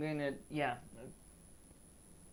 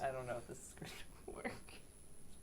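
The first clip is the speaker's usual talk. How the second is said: whispered aside; 3 semitones lower, 13 dB quieter than usual. Real, not acted. Nervous aside before command.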